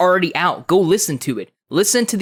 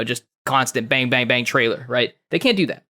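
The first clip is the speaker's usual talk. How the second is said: ordinary talk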